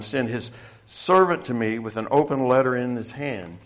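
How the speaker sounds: loud, emphatic pulpit reading; ringing, forceful Bible reading with punched phrases; scripture reading. Real, not acted. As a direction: declaim the passage to the congregation. Reads the scripture passage aloud.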